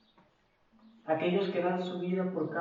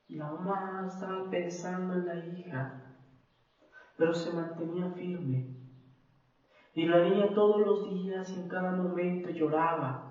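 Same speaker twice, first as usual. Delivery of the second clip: ordinary talk, nothing marked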